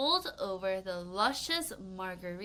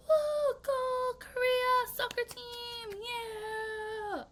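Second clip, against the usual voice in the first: high, slow cheering like an excited fan; 13 semitones higher, much slower than usual. Pretend. A fan cheering on the Korea soccer team, high and excited. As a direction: whoop it up for the team. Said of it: "So he's like".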